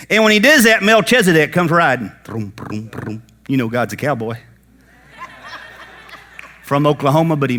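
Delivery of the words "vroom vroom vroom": engine vroom. Pretend. Melchizedek rides in.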